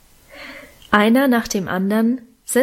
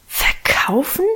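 higher, whispered shocked question; horrified whisper; 4 semitones higher, slower than usual. A narrator's reading. Siblings react to being sold, horrified whisper. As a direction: gasp it out in a rising whisper. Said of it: "raunten und flüsterten wir alle entsetzt".

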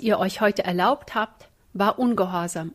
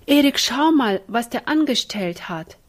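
louder, higher call for help; calling out in dismay; 4 semitones higher, 5 dB louder than usual. Acted. Ina calls Erik to see the mess, dismayed. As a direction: summon someone to see the mischief.

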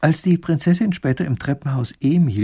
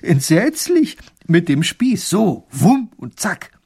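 thrilled horror; 6 semitones higher, 6 dB louder, slower than usual. A narrator's reading. Wife thrilled by dragon tale.

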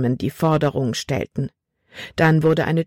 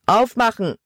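higher, forceful shout at a door; called-out command; 6 semitones higher than usual. A narrator's reading. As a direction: demand to be let in. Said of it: "rief ein Mann". (fordernd gerufen)

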